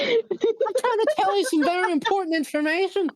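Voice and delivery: insistent high Irish brogue; Irish accent. Acted. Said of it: "You sound so funny".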